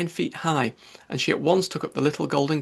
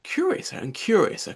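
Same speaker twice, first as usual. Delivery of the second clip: excited cry; 7 semitones higher than usual. A narrator's reading. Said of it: "cried Alice".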